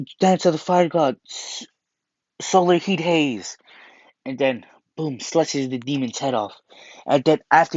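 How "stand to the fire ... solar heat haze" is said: shouted attack name. Retold or imitated. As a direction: call out the sword move like an anime hero.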